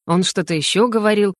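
plain reading voice

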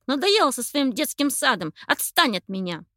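loud irritated brush-off; angry. A narrator's reading. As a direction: shove her away with words. Angry sparrow snaps at her.

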